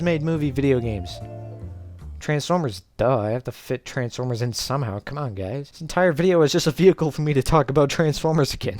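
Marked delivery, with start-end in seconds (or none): none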